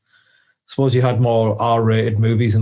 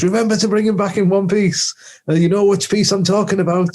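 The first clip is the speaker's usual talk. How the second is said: higher-pitched mock female voice; Connor's girlfriend; 7 semitones higher than usual. Girlfriend calls out to Cousin Dougal, mock higher voice. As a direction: pitch it up to play the sweetheart. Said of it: "his girlfriend is, like, called out".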